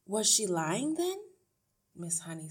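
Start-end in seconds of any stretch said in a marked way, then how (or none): 0.0-1.2 lightly voiced teacher, rising high and louder; a hint of challenge, lifting at the end; questioning teacher. A narrator's reading. Miss Honey challenges the mother, questioning.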